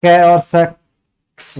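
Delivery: कै और सेक्स said slowly as two separate pieces, कै then सेक्स; sound split apart. Shown as a demonstration. Teacher showing students how X splits into कै and से, slowly. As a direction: break कै और सेक्स into slow, separate parts. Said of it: "आवाज से निकलती एक्स".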